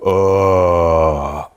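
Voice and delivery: long, low groaned "ooh"; blissed-out low ooh. Shown as a demonstration. Coach demonstrates the last exercise, deep blissful ooh. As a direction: sink into a drawn-out deep moan of pleasure. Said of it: "I want it to sound like you're getting".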